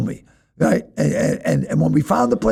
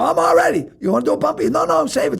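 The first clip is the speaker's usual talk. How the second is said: wired, eager; 10 semitones higher than usual. Retold or imitated. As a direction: bounce with coked energy. Eager coked-up talk at door.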